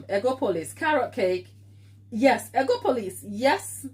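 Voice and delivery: ordinary delivery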